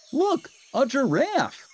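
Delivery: very high, loud joyful cry; Ernie crying out. A narrator's reading. Ernie seeing Bert is safe, high joyful shout. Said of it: "Ernie cried".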